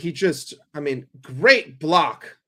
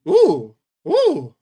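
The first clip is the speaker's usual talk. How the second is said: two high, loud whooping oohs; sudden high oohs at a near chance; excited ooh; 6 semitones higher, 8 dB louder than usual. Real, not acted. Fan watching a ball in behind, excited oohs.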